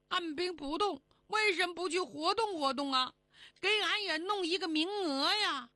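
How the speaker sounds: high, pleading little rabbit voice; whining Jade Rabbit. Acted. Rabbit begs the moon fairy for a place, high whine. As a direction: pester like an overlooked pet rabbit.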